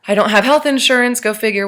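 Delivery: wry exasperation. Real, not acted. Telling viewers she got hurt uninsured, wryly exasperated. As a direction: throw it out like a bitter joke. Wry punchline about hand injury.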